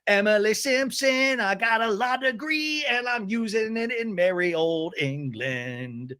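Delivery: a hint of a posh British accent, slowed at the start; mock-grand British lawyer voice. Mocking Emily as posh lawyer.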